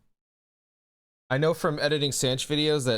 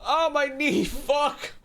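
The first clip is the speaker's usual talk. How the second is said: pained yelp; 13 semitones higher, much slower than usual. Real, not acted. Hurts his knee mid-stream.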